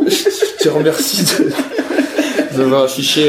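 high, slow laughing words. Real, not acted. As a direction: speak through laughter. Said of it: "laughing while thanking".

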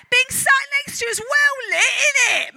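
loud, high North London slang; North London accent. Shown as a demonstration. Speaker models a line for your neighbour, North London accent. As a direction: belt it out in North London style. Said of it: "in your best North London accent".

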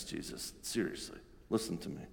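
soft, hushed plea; whispered, pleading aside; urgent whisper. Acted. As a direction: pull him aside and whisper. Peter pleading aside to Jesus.

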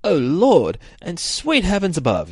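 high, hammed-up cry to heaven; mock dismay. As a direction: exclaim like a shocked old TV character. Old TV character's shock, acted.

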